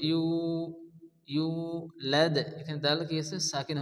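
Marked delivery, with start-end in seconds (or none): 0.1-1.5 ইউ with a drawn-out "oo"; one-alif stretch. Shown as a demonstration. Teacher showing the madd length, stretched vowel in ইউ. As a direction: hold the long vowel in ইউ. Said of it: "এক আলিফ পরিমাণ টান দিয়ে".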